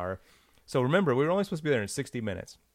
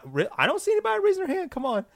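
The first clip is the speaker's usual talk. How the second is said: much higher, fast coaxing; salesman voice; faster than usual. Retold or imitated. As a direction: coax the crowd like a pitchman. Salesman pleading with audience.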